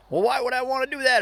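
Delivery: high, loud indignant objection; mad older lady. Retold or imitated. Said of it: "getting madder and madder".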